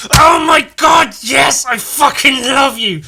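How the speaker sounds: slow, high, loud overjoyed shout; thrilled shout. Retold or imitated. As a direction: yell with sheer delight. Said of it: "And I'm like".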